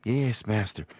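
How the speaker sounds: a different servile voice; bow and scrape like a minion; servant voice. Shown as a demonstration. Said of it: "You could go like".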